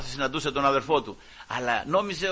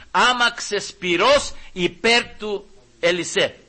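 slow, drawn-out awed proclamation; solemn proclamation; 5 semitones higher, 6 dB louder, much slower than usual. Acted. Preacher revealing the fiery chariots: slow proclamation. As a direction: lay out each word slowly in awe.

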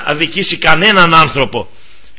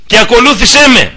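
raised call; 6 semitones higher, 4 dB louder than usual. Retold or imitated. Christ's call to follow.

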